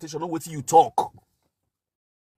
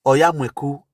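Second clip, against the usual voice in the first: usual speaking voice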